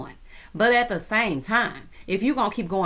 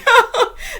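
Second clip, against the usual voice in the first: high, loud burst of laughter; cracking up at her own impression; sudden loud cackle; real laugh; 11 dB louder than usual. Real, not acted. Amused by her Karen impression.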